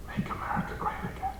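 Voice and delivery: put-on a light, high, whispered African accent, fast. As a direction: quickly breathe out the slogan in a mock African accent. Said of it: "in a relatively thick African accent".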